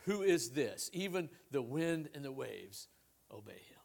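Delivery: low, measured Bible reading to a congregation; Scripture reading. Acted. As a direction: read the passage solemnly, softening toward the end. Reads the Scripture passage aloud.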